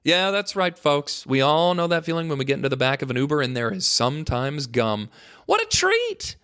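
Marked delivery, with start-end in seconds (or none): 5.5-6.4 high, squealing mock delight. Host gushing over free gum in an Uber, high mock squeal. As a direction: squeal like a kid handed candy.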